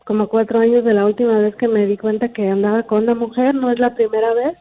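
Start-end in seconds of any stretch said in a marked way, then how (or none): none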